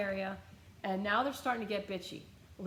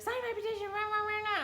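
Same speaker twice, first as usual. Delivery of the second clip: high mocking squawk of a ranting woman; mocking the petition lady; 13 semitones higher than usual. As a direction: parody her nagging, pitched way up. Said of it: "she's like".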